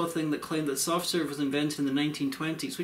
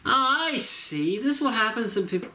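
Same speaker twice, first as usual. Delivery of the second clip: hammy villain voice; 7 semitones higher than usual. Pretend. Film reviewer bit.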